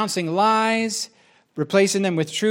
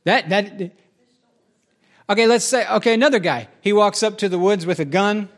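ordinary talk

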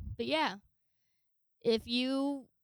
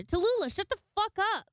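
mock yell; 8 semitones higher, 3 dB louder than usual. Owner yelling at the dog.

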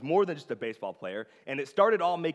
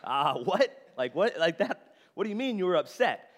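a mock startled reply, pitched up; incredulous, a bit louder; surprised; 6 semitones higher, 4 dB louder than usual. [name] reacting to the girl's confession, mock surprised. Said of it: "And I was like".

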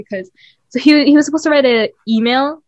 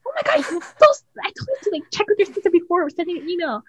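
high-pitched mock scolding without real volume; imitating yelling mom; 8 semitones higher than usual. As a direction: act out a mother's exasperated telling-off. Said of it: "started yelling".

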